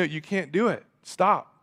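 ordinary talk, nothing marked

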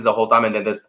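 flat monotone delivery. Retold or imitated. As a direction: drone in a monotone voice. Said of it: "The monotone".

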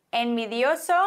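ordinary talk

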